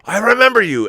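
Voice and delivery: excited Dutch director, recognizing someone; imitating Verhoeven. Retelling Verhoeven's delighted reply.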